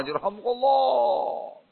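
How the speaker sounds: high, slow, soft distant echo; echoing crowd chorus. Retold or imitated. Blessing resounds through the market, echoing chorus.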